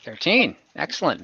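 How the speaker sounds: usual speaking voice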